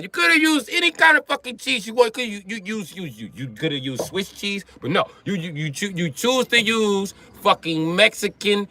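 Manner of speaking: mock Italian accent, exasperated scolding; angry Italian. Comic's Italian scolds the lasagna maker in mock Italian accent. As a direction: play the offended Italian berating the cook. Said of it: "Italians be mad about it".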